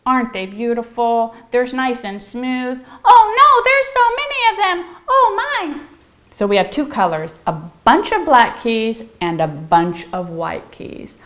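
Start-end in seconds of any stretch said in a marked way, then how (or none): 3.0-5.8 high, loud and fast mock panic; crazy excited voice. Teacher hamming up a crazy voice at the keys. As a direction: squeal it like keys overwhelm you. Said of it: "make that voice again".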